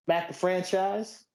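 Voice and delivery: slow, drawn-out ring announcer. Acted. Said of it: "All right".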